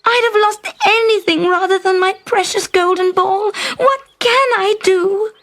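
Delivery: high, loud wailing lament over the lost ball; distressed princess. Acted. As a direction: cry out in dismay, voice pitched high.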